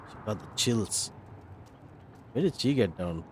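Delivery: usual speaking voice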